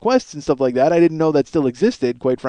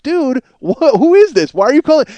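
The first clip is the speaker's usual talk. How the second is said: baffled DJ; 7 semitones higher than usual. Retold or imitated. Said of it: "Richard Blade is like".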